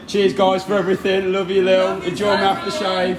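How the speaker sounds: high, loud calls of thanks; shouted goodbyes. Real, not acted. Calling goodbye to friends as he leaves, loud.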